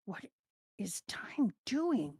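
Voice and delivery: slow, soft whisper; hushed, drawn-out aside; whispered wondering. Acted. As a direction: whisper the question to yourself. Acted wondering aside in battle.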